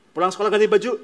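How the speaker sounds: parent's command. Acted. Parent telling child to change clothes, loud and firm. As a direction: bark it like a parent's instruction.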